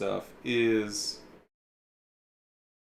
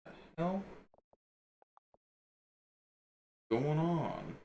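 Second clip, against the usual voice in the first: baffled; 6 semitones higher, 8 dB quieter, slower than usual. Real, not acted. Baffled by software glitch.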